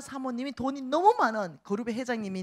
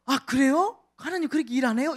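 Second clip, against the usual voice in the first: mock surprise; 6 semitones higher, 6 dB louder than usual. Listeners quoted, swayed.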